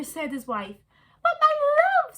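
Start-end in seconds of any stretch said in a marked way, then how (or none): 1.2-2.2 high, loud squeaky meerkat; a chirpy little meerkat answering his love. A narrator's reading. Meerkat Kevin to his wife, high and eager.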